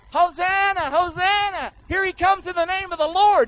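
high, excited crowd cheer; high joyful hosanna chant. Acted. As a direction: hail him like a thrilled crowd. Crowd cheering Jesus arriving.

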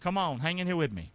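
ordinary talk

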